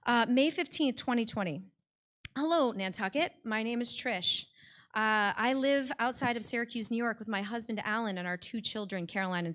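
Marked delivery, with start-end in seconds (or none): none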